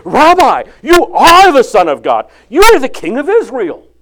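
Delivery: loud, high proclaiming voice; fervent declaration. Acted. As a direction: proclaim it with awe. Nathanael's awed declaration.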